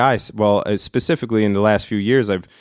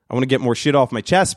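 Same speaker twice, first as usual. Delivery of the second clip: usual speaking voice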